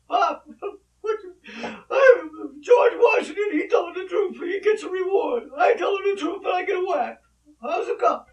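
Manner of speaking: hammed-up sobbing kid, very high and loud; crying through tears. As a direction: wail a mock-tearful protest to pop. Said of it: "Through the tears".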